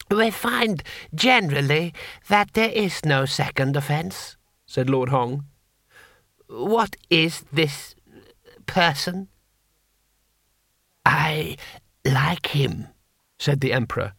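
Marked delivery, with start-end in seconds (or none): 0.1-4.4 higher, measured courtier; deliberate, condescending official reply; Lord Hong, cold vizier. Acted. Vizier answering Rincewind, high measured voice. 6.5-12.9 put-on slow, halting old ruler; ancient emperor. Emperor taking to the foreigner, slow halting voice.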